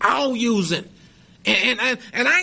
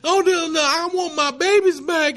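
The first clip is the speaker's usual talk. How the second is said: higher, louder mock-tearful wail; retold crying lady; 6 semitones higher, 3 dB louder than usual. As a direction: echo a crying mother, lightly acted. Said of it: "she was crying".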